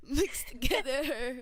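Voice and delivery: amused drawn-out punchline; laughing slow drawn-out. Shown as a demonstration. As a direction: stretch it out through a laugh. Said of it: "is this love class or love coaching?".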